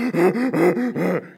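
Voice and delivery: loud steam-engine gasps; heavy breathing. Retold or imitated. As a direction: heave out hard, panting breaths like a steam engine. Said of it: "my breathing is getting heavier".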